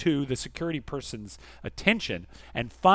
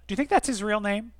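ordinary delivery

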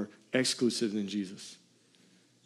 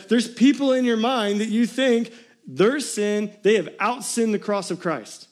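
raised, urgent preacher voice; fiery preaching; 8 semitones higher, 5 dB louder than usual. Real, not acted. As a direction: preach it hard to the congregation. Preaching at full force.

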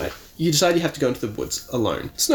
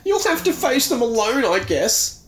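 put-on whiny kid voice; 13 semitones higher, 3 dB louder than usual. Todd voiced whining.

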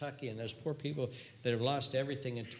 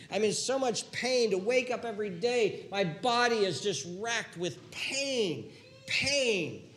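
louder, higher, slow moan of pain; anguished complaint; 7 semitones higher, 5 dB louder, slower than usual. Acted. Sufferer in chronic pain complaining, anguished. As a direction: drag out the pain in the words.

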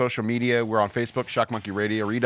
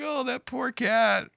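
mock pity; 13 semitones higher, slower than usual. Mock sympathetic commuter.

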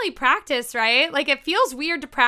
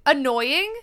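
ordinary talk, nothing marked

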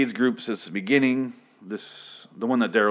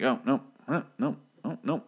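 mock straining reach; 5 semitones higher, 4 dB quieter than usual. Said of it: "trying to reach slowly for the rocket".

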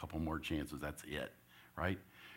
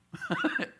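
high giggle. Real, not acted. Laughing at throwing the game.

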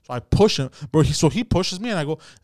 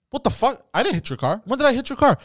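higher indignant denial; retold outrage; 9 semitones higher than usual. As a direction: protest the accusation hotly. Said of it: "So I go".

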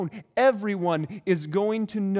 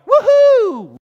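high, loud crowd whoop; excited cheer; 15 dB louder than usual. Acted. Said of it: "Everybody's so excited".

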